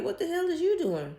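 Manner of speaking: high shocked shout of an angry father; retold yell. Dad catches her packing up.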